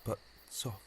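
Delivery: whispered, catching his breath at the sight; a breathless whisper in the dark garden; hushed whisper. Acted. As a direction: hush yourself, something's there. Romeo hushes himself seeing light.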